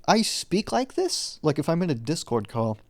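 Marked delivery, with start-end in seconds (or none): 0.0-1.4 much higher, incredulous self-quote; mock disbelief. Vlogger reacting to hearing own recordings, mock disbelief. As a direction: squeak it out, baffled at your own voice. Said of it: "when I listen to it, I'm like".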